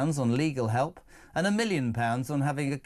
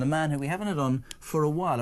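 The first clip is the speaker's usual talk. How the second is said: ordinary delivery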